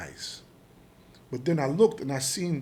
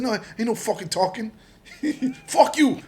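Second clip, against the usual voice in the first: raised, defiant retold outburst, breaking into a laugh; going off angrily; 9 semitones higher, 7 dB louder than usual. Suspect refusing to talk to agents, angry retold outburst. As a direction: snap back high, ending in a louder curse. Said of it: "I was going off on the motherfuckers".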